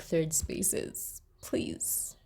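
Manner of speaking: breathy whispered begging, slow; hushed pleading whisper; whispered plea. Real, not acted. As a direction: whisper the plea like a secret wish. Pleading aside about third spaces.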